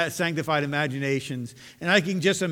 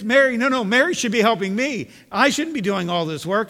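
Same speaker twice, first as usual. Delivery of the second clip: higher, louder and exasperated, as overworked Martha; upset Martha; 6 semitones higher, 7 dB louder than usual. Acted. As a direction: complain like a fed-up sister stuck with the chores. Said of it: "Martha thinking here is upset".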